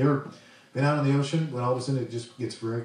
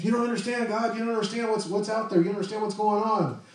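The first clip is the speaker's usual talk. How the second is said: high, anxious pleading voice; mock distress, pitched up; imitating a panicked person; 9 semitones higher than usual. Preacher voicing frightened person, high.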